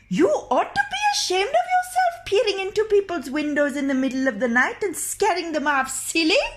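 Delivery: high, loud scolding crone; scolding old woman. Acted. The old woman scolds the doctor for peering in, high and loud. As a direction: tell off the man at the window, high and sharp.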